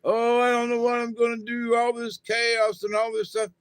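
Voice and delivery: mock whining. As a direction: rattle it off like a panicking complainer. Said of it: "quit whining".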